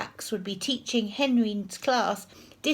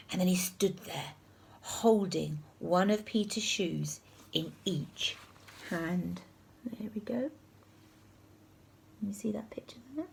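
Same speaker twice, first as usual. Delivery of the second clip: whispering; 6 dB quieter than usual. Real, not acted.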